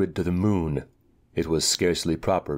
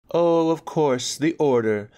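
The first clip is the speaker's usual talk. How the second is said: mock knowing; 10 semitones higher, 3 dB louder than usual. Anika voiced, mocking tone.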